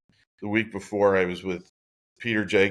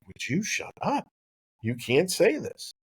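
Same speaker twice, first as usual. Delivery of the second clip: hushed scold; 9 semitones higher than usual. Retold or imitated. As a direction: shush him before anyone hears. Dad hushing son about driver.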